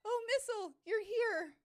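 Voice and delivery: high, loud fretful voice; worried girl voice. Acted. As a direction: voice a scared little helper.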